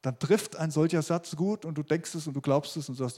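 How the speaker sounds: ordinary talk, nothing marked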